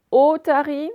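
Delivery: "otarie" with the opening O held and brought out; accent sur le O. Shown as a demonstration. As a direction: lean long on the first O of "otarie". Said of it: "On l'entend au tout début". (« otarie », le O initial bien appuyé)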